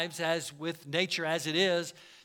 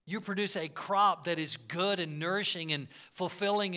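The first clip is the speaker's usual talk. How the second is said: ordinary talk, nothing marked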